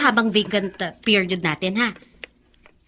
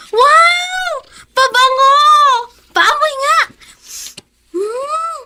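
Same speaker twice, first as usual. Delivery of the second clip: high thrilled squeal; excited delight; 12 semitones higher, 3 dB louder, slower than usual. Acted. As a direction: gush over the gift. Daughter opens gift. (tuwang-tuwa sa regalo)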